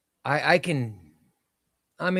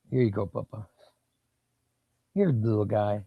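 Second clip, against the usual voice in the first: talking to the dog; 3 semitones lower than usual. Real, not acted. Praising his dog Chester at treats.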